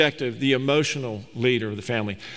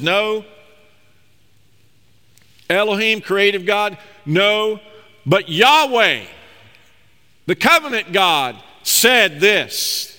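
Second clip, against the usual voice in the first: loud, higher, insistent no; firm rebuttal; 5 semitones higher, 5 dB louder, slower than usual. Acted. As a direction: shut the tempter down. Preacher voices what Eve should answer.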